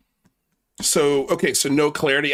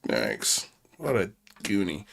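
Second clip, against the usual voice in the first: low quiet mutter of disbelief; muttered under breath; 6 semitones lower, 7 dB quieter than usual. Shown as a demonstration. Host mutters about the troll caller, low and quiet. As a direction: say it low to yourself. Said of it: "repeats line differently".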